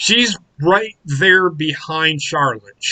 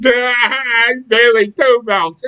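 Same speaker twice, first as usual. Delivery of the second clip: slow, higher, louder shouted boast; loud mocking Bayley; 5 semitones higher, 4 dB louder, much slower than usual. Mocking Bayley again to a friend, slow shouted boast. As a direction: yell the brag like a dumb champion. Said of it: "that's exactly the way she fucking sounds".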